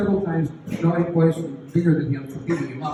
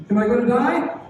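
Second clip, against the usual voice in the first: put-on scared kid; 6 semitones higher, 5 dB louder than usual. Frightened boy asks teacher.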